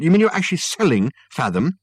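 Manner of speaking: high, loud disbelief; stunned. A narrator's reading. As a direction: question it as a shocked son. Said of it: "said a stunned Michael".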